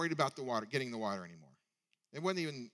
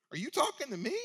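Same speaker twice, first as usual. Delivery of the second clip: high, louder, incredulous rising question; defiant retort; 12 semitones higher, 5 dB louder than usual. Retold or imitated. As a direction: throw the challenge right back. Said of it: "Basically, she says".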